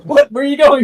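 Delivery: surprised. Acted. Owl's startled call in scene.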